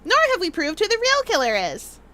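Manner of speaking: normal talking voice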